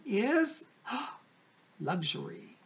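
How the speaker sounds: slow, gasp before the word; gasp, then reveal it slowly; dramatic gasp reveal. Acted.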